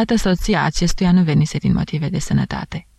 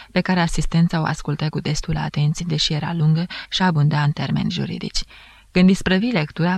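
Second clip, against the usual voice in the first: ordinary delivery, nothing marked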